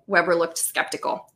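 ordinary delivery, nothing marked

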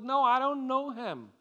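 high, loud acted protest; preacher voicing a vehement denial; emphatic denial. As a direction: deny it outright, pitched far up. Peter denies the accusation.

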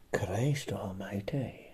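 breathy whispered Christ almighty, drawn out slowly; whispered curse. Acted. Comic muttering a slow whispered curse after his joke.

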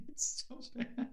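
soft, slow words lost in laughter; breathless laughing. Real, not acted. Host cracking up at the crane joke, laughing. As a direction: barely get it out for laughing.

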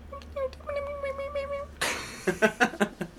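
high chirping bird imitation; bird call. Shown as a demonstration. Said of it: "Make that sound".

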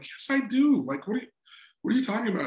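high-pitched mock indignation; baffled comeback, voice lifting high; retold defensive protest. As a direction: act out protesting to your wife, pitch jumping up. Retold protest to his wife.

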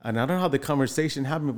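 ordinary talk

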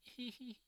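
high, soft "hee hee" giggle; little giggle. Retold or imitated. Friend recalling his own laugh at a classmate's antics, little giggle. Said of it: "like, a little giggle from me".

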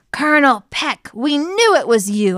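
loud, high accusation; accusing. A narrator's reading. Detective confronts the unmasked colonel, loud accusing tone. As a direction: call out the culprit forcefully. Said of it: "I accused".

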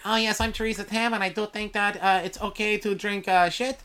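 a lightly mocked health officer; Theresa Tam impression. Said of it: "Theresa Tam here".

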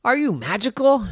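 put-on high amazed child voice; amazed whoa. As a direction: gasp in awe at the stairs. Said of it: "Monty said".

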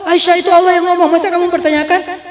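loud, high mock scolding; indignant rebuke. Husband rebuking a doubting wife, mock-indignant. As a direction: press the point as an outraged rebuttal.

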